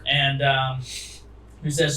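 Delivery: ordinary delivery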